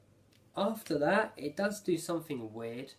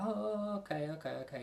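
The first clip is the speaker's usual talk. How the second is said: imitated catchphrase; 8 semitones higher than usual. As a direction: start high and stretched, then drop. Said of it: "it goes like that".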